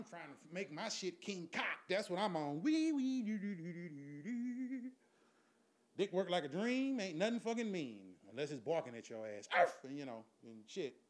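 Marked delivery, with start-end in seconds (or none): 2.6-4.9 high wees falling into trailing doos; comic vocal sound effect. Acted. 9.6-9.8 sudden imitated bark; dog bark. Imitates barking.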